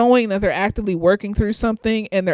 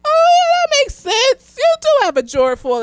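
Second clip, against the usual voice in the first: much higher, light and bright agreement; high compliant agreement; 3 dB louder than usual. Retold or imitated. Agreeing with the partner's logic, a high eager voice. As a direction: sound eagerly convinced, pitched way up.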